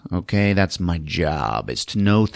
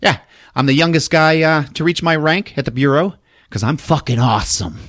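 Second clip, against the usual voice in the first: fast, higher, louder brag; cocky agent; 9 semitones higher, 4 dB louder, faster than usual. Retold or imitated. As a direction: rattle it off like a smug hotshot. Cocky FBI agent brags.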